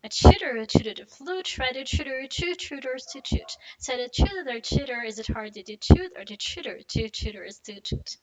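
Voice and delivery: quick run, words linked; fast tongue twister. Shown as a demonstration. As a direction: rattle it off fast. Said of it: "you wanna do it fast".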